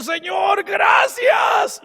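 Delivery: very high, loud, jubilant cry; shouted thanks. Acted. Samaritan who came back to thank Jesus, jubilant shout. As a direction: shout gratitude up to the Lord. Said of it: "regresó y dijo".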